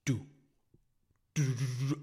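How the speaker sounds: one step, then a rapid burst; loud, quick "do-do-do" patter; imitated footsteps. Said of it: "dude, the footsteps".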